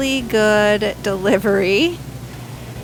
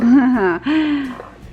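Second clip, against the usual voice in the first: amused laugh; 3 semitones higher, 3 dB louder than usual. Real, not acted. Laughs at own overpour.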